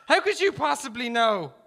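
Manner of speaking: high, loud challenge; shouted retort. A narrator's reading. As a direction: throw the question back at him loudly. Gunman shouts back at negotiator.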